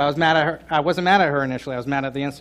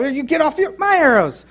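high, louder mock retort; the other spouse answering in a high mock snap; pretend spouse snapping back; 11 semitones higher, 6 dB louder than usual. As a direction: throw it right back. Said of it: "they go".

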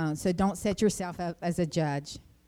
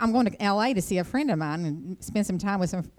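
ordinary talk, nothing marked

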